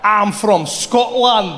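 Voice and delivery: very slow, loud mock Scottish; bad Scottish accent. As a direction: claim to be Scottish, drawing out every word. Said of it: "speak to people in their accent".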